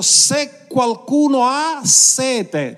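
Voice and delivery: high, slow ringing call; slow, high, ringing preacher's call; exclaiming. Acted. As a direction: cry it out to the whole crowd. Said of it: "esclamò dicendo".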